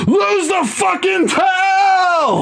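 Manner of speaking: drawn-out, high-pitched imitation yell; Nicholson's sudden shout. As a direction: explode into a long mock scream. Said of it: "Nicholson's idea was like".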